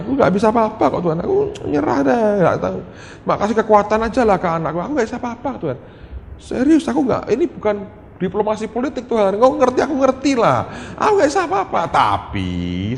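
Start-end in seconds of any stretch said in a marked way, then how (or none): 0.0-11.8 very high-pitched cry of surrender; desperate pleading. Retold or imitated. Father surrendering to God over his child, desperate pleading. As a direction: throw up your hands and plead, voice pitched high.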